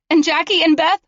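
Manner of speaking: high, sharp accusing question; defiant girl. Acted. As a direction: throw it back at him. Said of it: "she asked".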